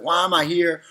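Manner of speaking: mock distressed wail, only lightly acted; retold crying. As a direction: retell a child's tearful plea without real sobs. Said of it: "I was just crying and crying".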